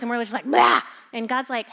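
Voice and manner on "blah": loud mock blurt; mock outburst. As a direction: blurt it out like an overwhelmed mess.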